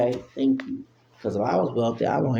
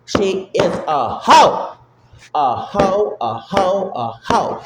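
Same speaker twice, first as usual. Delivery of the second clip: louder and slower, hammering each word; loud, slow insult; 5 semitones higher, 5 dB louder, slower than usual. Real, not acted. Ranting to viewers about Kamala, loud slow insult. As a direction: land the insult hard and slow.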